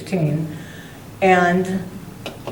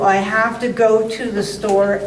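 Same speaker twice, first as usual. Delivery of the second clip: ordinary talk, nothing marked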